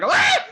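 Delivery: high, loud frightened shriek; startled yelp. Acted. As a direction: jump out of your skin with a yelp. Startled by a shoulder tap.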